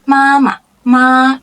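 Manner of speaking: 妈妈 with a high, level first syllable. Shown as a demonstration. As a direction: hold the first part of 妈妈 high. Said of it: "is the first tone".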